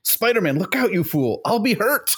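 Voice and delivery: a coward shrieking for help, mock; cowardly editor. Voicing cowardly Jameson from comic.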